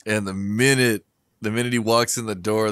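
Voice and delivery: ordinary delivery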